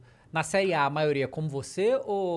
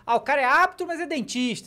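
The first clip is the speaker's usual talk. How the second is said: mocking the media; 9 semitones higher, 4 dB louder than usual. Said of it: "eles sempre dizem assim".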